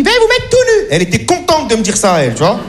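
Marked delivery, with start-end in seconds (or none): 0.0-0.9 high, prissy receptionist voice; high mock assistant. Mocking the doctor's assistant greeting him, high and prim. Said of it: "Elle me dit".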